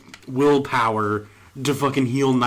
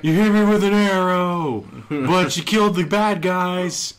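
higher, whiny crybaby imitation with a laugh; mock crybaby; 6 semitones higher than usual. Player mocking the character hit by an arrow, crybaby whine. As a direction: mimic a crybaby's complaint. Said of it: "She really is a crybaby bitch".

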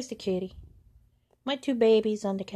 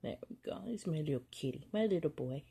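soft cooing; 4 dB quieter, faster than usual. Real, not acted. Cooing softly to the cat.

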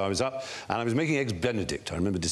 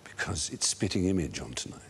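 confiding; 7 semitones lower, 5 dB quieter than usual. Retold or imitated. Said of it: "and she says".

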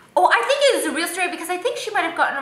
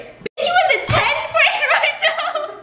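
high incredulous laughing question; outraged disbelief; 12 semitones higher than usual. Real, not acted. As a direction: call out the obvious lie. Mocking confessor's math.

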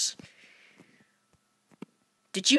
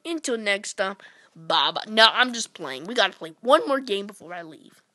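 normal talking voice

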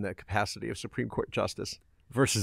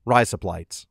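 Australian accent; 7 dB louder, slower than usual. Shown as a demonstration. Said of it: "razor blades in an Australian accent".

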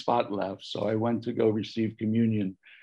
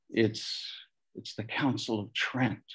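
hushed, halting prompt; imitated whisper; 3 semitones lower, 7 dB quieter than usual. Parishioner slipping him the answer from behind, imitated whisper. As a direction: pass it on softly, like a secret. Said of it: "whispers in my ear".

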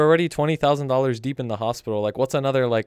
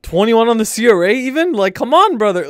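loud, raised-pitch remonstrating; exasperated protest; 8 semitones higher, 9 dB louder than usual. Real, not acted. Player scolding friend over twenty-one, exasperated protest. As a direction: call your friend out, loud and incredulous.

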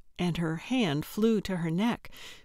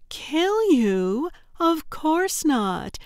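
mock surprise; 8 semitones higher, 3 dB louder, much slower than usual. Loretta feigns shock.

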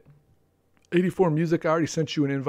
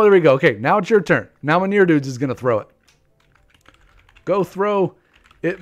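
ordinary talk, nothing marked

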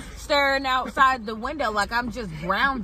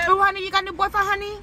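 high, sing-song salon lady; nail salon worker impression; 8 semitones higher, much faster than usual. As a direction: coo it like a nail tech.

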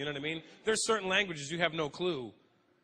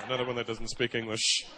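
muttering cop; 7 semitones lower than usual. Retold or imitated. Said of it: "pulled you over".

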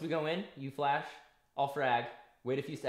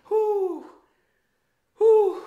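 high loud exhale of relief; loud relieved whew; 16 dB louder than usual. Real, not acted. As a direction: blow out relief after the fight. Relief after the round.

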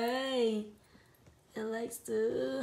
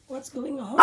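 ordinary talk, nothing marked

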